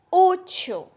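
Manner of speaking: útil with Ú loud and high; stress on first syllable. Shown as a demonstration. Teacher giving a penultimate-stress example, stressed útil. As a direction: hit the ú of útil, let til drop. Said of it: "A mais forte é o U".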